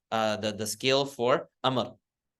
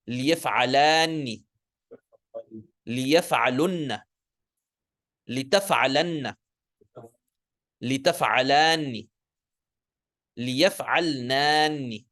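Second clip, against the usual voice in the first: say-after-me drill. Shown as a demonstration. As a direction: recite each form for the class to repeat. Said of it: "say it after me".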